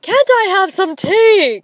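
high, loud plaintive plea; wailed, desperate request; despairing cry. A narrator's reading. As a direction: beg in despair. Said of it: "cried Mamma, in despair".

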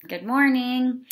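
bright, high sing-song hello to the class; cheery greeting. Real, not acted. Teacher greeting her class with a bright sing-song hello.

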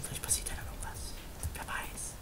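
soft breathy muttering to self; hushed hope while picking the lock; whispered aside. Real, not acted. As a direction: whisper it under your breath.

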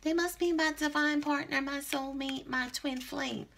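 mock the clients who swear it's their divine flame; mocking the lovesick client. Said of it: "we gonna go ahead and use that term".

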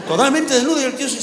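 normal talking voice

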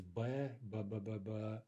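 б repeated as a bare consonant, "buh"; sounding out б. Shown as a demonstration. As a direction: test the lip sound б several times in a row. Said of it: "tell just by sounding it".

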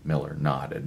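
ordinary delivery, nothing marked

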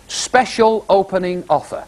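slow, deliberate sign reading; measured announcement of a shop offer. Retold or imitated. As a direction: draw each word out for the punchline. Reads out shop sign for punchline.

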